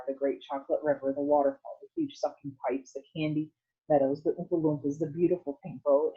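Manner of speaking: usual speaking voice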